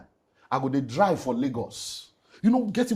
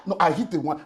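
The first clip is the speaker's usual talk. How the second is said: ordinary talk, nothing marked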